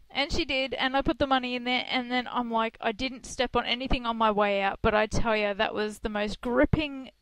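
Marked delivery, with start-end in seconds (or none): none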